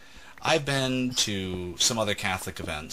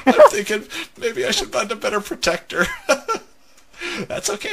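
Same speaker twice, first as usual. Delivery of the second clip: high, loud, talking through laughter; cracking up while speaking; laughing; 13 semitones higher, 7 dB louder than usual. Real, not acted. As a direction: barely get the words out for laughing. Cracking up over co-player's failure.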